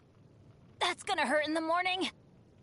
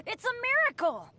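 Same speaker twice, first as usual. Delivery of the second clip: high, elated exclamation after a laugh; delighted amazement; 7 semitones higher than usual. Acted. Unlucky adventurer finds treasure, delighted amazement. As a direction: marvel at the luck, pitch leaping up.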